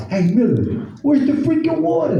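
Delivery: high, hammed-up Brooklyn Puerto Rican accent. Sweaty Brooklyn coworker begging for water, high mock accent. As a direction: squeal it out like a thirsty New Yorker. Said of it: "He's talking that way".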